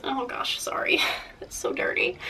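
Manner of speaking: embarrassed whispered aside with a laugh. Real, not acted. Embarrassed aside about dirty sponge.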